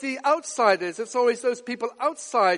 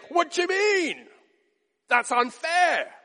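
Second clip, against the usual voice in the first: higher, slow mock indignation; indignant protest; 6 semitones higher, slower than usual. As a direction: play the offended victim. Imagined indignant retort.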